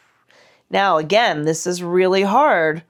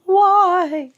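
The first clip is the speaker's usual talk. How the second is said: bright call; 12 semitones higher, 5 dB louder than usual. Real, not acted. Calls out the Y arm shape.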